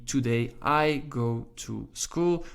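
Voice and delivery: slow, word-by-word English reading; deliberate teaching pace; slow example sentence. Shown as a demonstration. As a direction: set out each word separately for learners. English word order shown for contrast.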